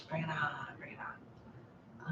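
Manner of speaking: breathy slow whisper. Acted. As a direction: whisper it slowly like a secret. Whispered aside to viewers.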